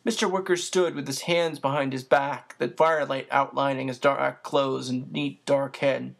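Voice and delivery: plain reading voice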